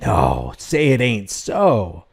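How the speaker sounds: slow, drawn-out sarcastic dismay; mock disbelief. Commentator reacts to officer being no good, mock-dismayed. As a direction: feign shock, dragging the words out.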